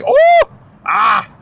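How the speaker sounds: high, loud sudden shout; excited scream. Retold or imitated. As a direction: yell at a goal attempt. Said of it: "I'm fucking screaming".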